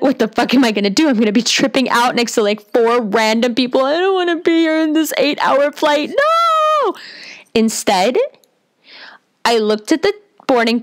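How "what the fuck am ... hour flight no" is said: comic inner meltdown, rising higher; retold panic. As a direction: play up the panic, pitch climbing to a squeal. Retold inner panic before flight.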